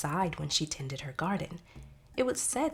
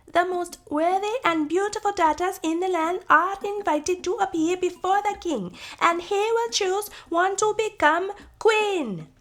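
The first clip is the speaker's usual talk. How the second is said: royal messenger; 14 semitones higher, 9 dB louder than usual. Acted. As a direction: proclaim it to the whole village. Messenger proclaims the king's call.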